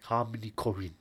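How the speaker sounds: put-on measured German-accented English; German film-director accent. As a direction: philosophize in a thick German accent.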